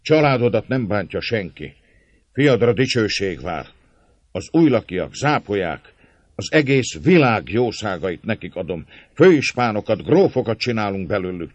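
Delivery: loud, high, fervent promises; heated cry. A narrator's reading. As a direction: proclaim each promise with mounting force. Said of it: "kiáltott György indulattal".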